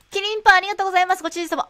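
high, loud and quick; quick bubbly maid thanks; maid cafe voice. Pretend. Maid-themed shop.

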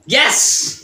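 high, loud burst of victory; triumphant cheer. Real, not acted. Rival celebrates in the challenge, high triumphant shout. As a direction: punch out a winning cry. Celebrating during the drinking contest.

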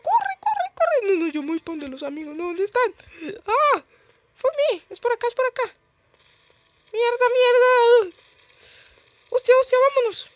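very high, loud panicky silly voice; scared stupid voice. Real, not acted. Player fleeing zombies toward teammates, panicked high silly voice. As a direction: squeal frantically in a daft, frightened high voice. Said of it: "tengo esta voz tan rara. Estoy haciendo una voz de estúpido".

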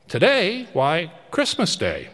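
put-on high puzzled boy answering up; a touch of a street boy's surprise; boy answering the odd old man above; surprised boy.

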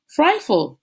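high, loud call of the falcon's name; shouted name. A narrator's reading. Sam calling up to his falcon, shouting. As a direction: call out to the bird overhead. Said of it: "he shouted".

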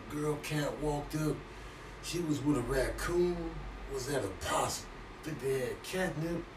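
half-awake and confused; groggy hungover mumble. Acted. Groggy cat after night out.

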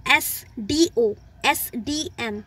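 letters spaced out slowly; slow spelled letters. Shown as a demonstration. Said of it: "जब भी आपके स sound की प्रोनाउंसिएशन एस". (अक्षर धीरे-धीरे अलग-अलग बोलना)

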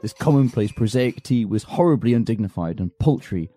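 low, soft narrating voice; story narration. A narrator's reading. Narrator describing the silent tea, low story narration. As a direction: narrate it quietly.

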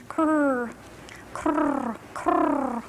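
rolling krrr crane call; crane cry. Shown as a demonstration. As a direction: trill a crane's croak. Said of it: "That was my crane impression".

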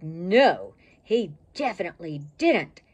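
loud growling refusal; growled. A narrator's reading. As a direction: growl it like a bulldog. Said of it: "he growled in Petey's face".

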